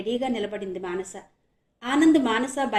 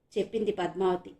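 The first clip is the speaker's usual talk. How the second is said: plain reading voice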